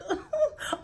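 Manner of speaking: short, very high whimper; mock crying noise. Telling how she wanted to cry, high mock whimper. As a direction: let out a tiny pretend sob. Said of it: "I wanted to freaking cry".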